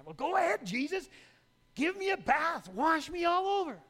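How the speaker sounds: high, loud, rushing to give in; impulsive eagerness. Retold or imitated. Peter eagerly begging Jesus to wash him all over. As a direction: swing all the way to overeager. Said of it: "respond impulsively".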